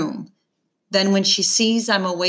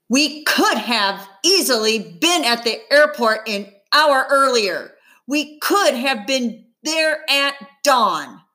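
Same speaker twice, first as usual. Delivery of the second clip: angry mom; 6 semitones higher than usual. A narrator's reading. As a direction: let it burst out at him. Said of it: "bursts out angrily".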